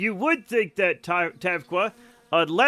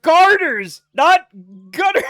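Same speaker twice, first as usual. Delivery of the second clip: much higher, loud corrective yell; exasperated shout; 9 semitones higher, 12 dB louder than usual. Real, not acted. Streamer loudly corrects chat's mishearing, exasperated shout.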